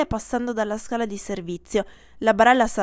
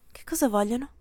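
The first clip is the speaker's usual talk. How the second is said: normal talking voice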